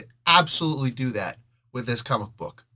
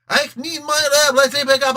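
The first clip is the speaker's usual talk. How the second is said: much higher and louder mock voice; hammed-up mock line; 12 semitones higher, 8 dB louder than usual. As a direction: blurt it like a silly overblown quote.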